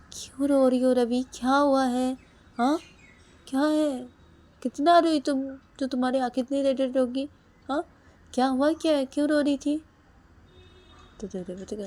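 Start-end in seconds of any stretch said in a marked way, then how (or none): 0.1-9.8 much higher, loud hammering questions; angry demand. Acted. Husband demanding why his wife cried, loud and angry. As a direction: keep demanding louder each time.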